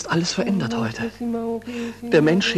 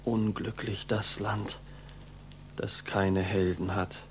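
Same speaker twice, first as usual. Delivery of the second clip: slow, much softer and lower; bitter, disappointed; 7 semitones lower, 11 dB quieter than usual. Acted. Pupil bitterly reproaching the teacher who recanted. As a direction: let the disappointment sink, slowly.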